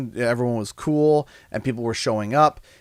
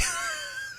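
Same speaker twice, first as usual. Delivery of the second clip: squealing laugh; 13 dB quieter than usual. Real, not acted. Laughs about son in broccoli.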